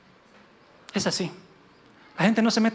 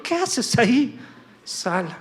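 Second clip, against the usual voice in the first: mild annoyed command; 4 semitones higher, much slower than usual. Acted. El Señor confronting Elías in the cave, mildly angry. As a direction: voice a displeased God, restrained. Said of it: "El Señor se enojó".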